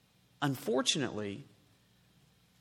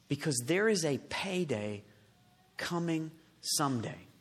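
ordinary talk

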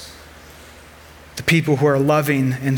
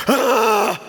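short imitated yelp of exasperation, pitched up; mock frustrated scream; 7 semitones higher than usual. Mom slamming her car door, an imitated exasperated yell. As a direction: fling out a sharp exasperated "Ah!". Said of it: "she just gives, like, a".

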